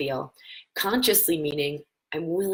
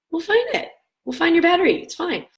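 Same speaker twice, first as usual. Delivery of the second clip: reassuring soothing; 12 semitones higher, 6 dB louder than usual. Retold or imitated. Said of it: "I was like".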